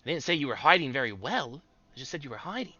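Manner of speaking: high, loud gnome; bickering little gnome. Acted. Teb bickers with party.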